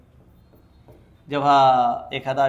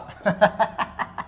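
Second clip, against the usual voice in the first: 1 laugh; 6 semitones higher than usual. Real, not acted. Teacher laughing at joke.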